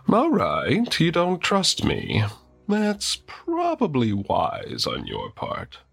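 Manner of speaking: high, slow, smooth villain. Acted. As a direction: purr it like a sly villain. Villain character voice.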